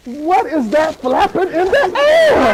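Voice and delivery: slow, half-laughing wonder. Retold or imitated. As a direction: slowly wonder through a laugh. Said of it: "I'm like".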